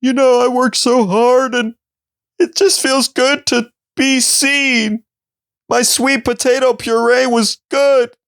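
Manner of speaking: high, slow, teary mock chef; crying chef contestant. Chef on Next Level Chef, mock weepy high voice. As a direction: play a chef crying to the camera. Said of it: "and he was like".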